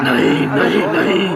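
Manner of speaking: rising, panicky refusal; alarmed denial. Acted. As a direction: push the memory away. Major snaps out of haunting memory. (घबराकर इनकार)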